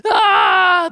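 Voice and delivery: sudden high, loud cry of terror; frightened scream. Retold or imitated. Preacher voicing the terrified disciples' scream. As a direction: let out the disciples' panicked scream. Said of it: "you can even hear them screaming".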